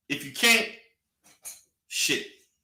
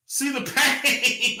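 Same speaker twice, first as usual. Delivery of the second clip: drawn out, high and slow; slow teasing drawl; slow mockery; 4 semitones higher, much slower than usual. Real, not acted. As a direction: stretch each word to mock him. Drawn-out mocking line.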